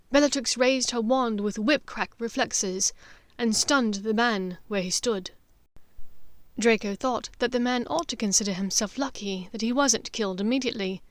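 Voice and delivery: usual speaking voice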